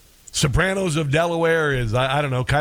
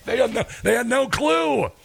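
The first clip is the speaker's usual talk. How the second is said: amused; 8 semitones higher than usual. Real, not acted. Amused after laughing at the blunder.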